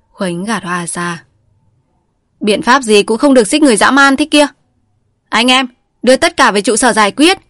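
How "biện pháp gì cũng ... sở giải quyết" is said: loud, harsh orders; angry command. A narrator's reading. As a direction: bark orders at the men. Khuếnh pushes Hoa, orders men. (ra lệnh giận dữ)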